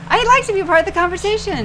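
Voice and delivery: whiny voice. Pretend. Plaintive back seat voice.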